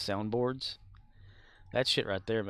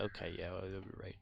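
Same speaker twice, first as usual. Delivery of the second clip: quiet; 3 semitones lower, 13 dB quieter, faster than usual. Retold or imitated. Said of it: "I was like".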